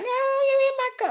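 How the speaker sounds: very high, shrill mock shriek; mock screaming woman. Mimicking the woman in the crash, high mock scream. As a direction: take off a panicking driver, hammed up. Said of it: "she's screaming out".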